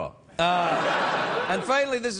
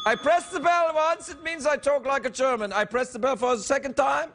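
lightly higher hammed German accent; mock German accent; 6 semitones higher than usual. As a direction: play a stiff German, lightly. Mock German magician routine.

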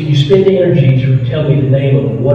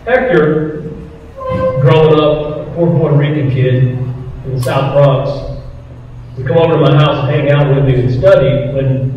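ordinary talk, nothing marked

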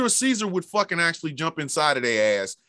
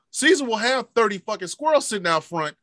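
ordinary talk

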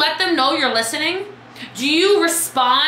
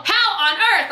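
amazed; 6 semitones higher, slower than usual. Retold or imitated. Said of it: "And people go".

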